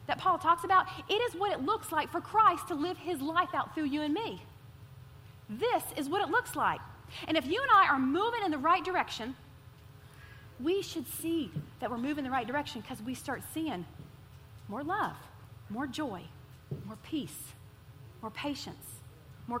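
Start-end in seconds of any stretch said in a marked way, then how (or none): none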